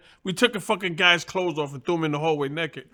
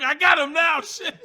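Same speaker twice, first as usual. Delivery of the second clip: opponent gloating; 9 semitones higher than usual. Retold or imitated. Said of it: "he's motherfucker".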